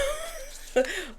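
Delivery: high, brief squeal of laughter; short high-pitched giggle; amused laugh. Real, not acted. Amused by celebrity type she pictures.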